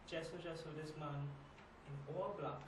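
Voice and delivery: hushed recounting. Acted. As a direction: recount the hold-up softly, with halting pauses. Recounting a frightening hold-up in monologue.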